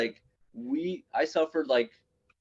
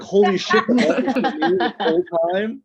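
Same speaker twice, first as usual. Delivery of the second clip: louder, higher, stunned outburst; shocked realization; 7 semitones higher, 9 dB louder than usual. Retold or imitated. Comic retelling his shock at his brother's revelation, stunned. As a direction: exclaim in mock disbelief.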